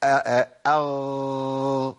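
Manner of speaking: slow held throaty aa-aa-agh, voice pushed on; drawn-out controlled ghain. Shown as a demonstration. Tajweed teacher demonstrating the wrong forced ghain, slow and drawn. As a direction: stretch أغ out as if forcing the sound. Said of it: "أتحكم في زيادة جريانه".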